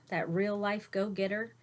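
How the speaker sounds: ordinary delivery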